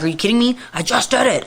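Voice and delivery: mock outraged disbelief; retold pissed off. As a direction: replay indignation at the machine. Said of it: "I was hella pissed".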